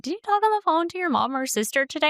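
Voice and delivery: high, rapid quizzing friend; mock a quick, high-pitched question from a friend; imitating best friend. Voices best friend quizzing her.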